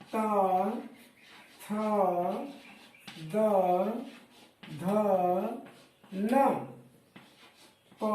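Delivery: each letter said alone with long pauses; teacher reciting the alphabet for the class; a teacher slowly listing the Hindi consonants one by one; slow recital of the consonants. Shown as a demonstration. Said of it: "हमने कहा क से लेकर के म तक".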